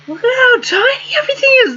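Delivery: high, squealing cry of disbelief; amazed squeal. Real, not acted. Gamer squealing at how tiny the screen is, amazed. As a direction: shriek it out in delighted shock. Marvelling at tiny game graphics.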